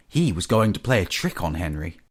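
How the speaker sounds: plain reading voice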